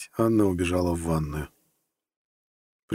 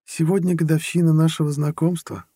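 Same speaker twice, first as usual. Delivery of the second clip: much higher woman's voice; reminding girlfriend; 9 semitones higher, 3 dB louder than usual. A narrator's reading. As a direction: remind him pointedly in a lifted feminine voice. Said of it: "напомнила подруга". (высокий женский голос, напоминание)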